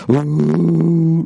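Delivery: high drawn-out crowd ooh; imitating a crowd going ooh; mock crowd ooh. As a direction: ooh like a stunned audience. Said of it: "the crowd all went".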